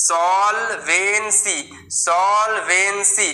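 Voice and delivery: drawn-out teacher demo of সলভেন্সি; slow spelled-out pronunciation. Shown as a demonstration. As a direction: say সলভেন্সি very slowly, syllable by syllable. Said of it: "clear?".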